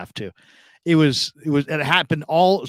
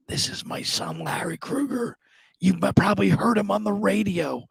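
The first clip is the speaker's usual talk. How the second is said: imitating a feeble, whispery patient; barely able to talk; 7 semitones higher, 5 dB quieter than usual. Sick dad introduces his son to nurse, barely able to talk. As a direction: force the words out softly, voice slipping upward. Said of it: "he's barely can talk".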